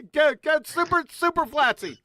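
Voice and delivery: squeaky loud mock cheer; high, silly impersonation; high-pitched cartoon voice. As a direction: playfully imitate a tiny high-voiced superfan. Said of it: "such a high-pitched voice you couldn't hear".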